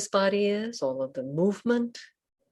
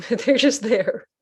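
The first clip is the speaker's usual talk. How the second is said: real chuckle breaking into the words; amused laugh; 5 semitones higher, 6 dB louder than usual. Real, not acted. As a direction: say it through a little laugh, drawn out. Amused by her own uncertainty.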